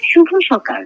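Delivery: usual speaking voice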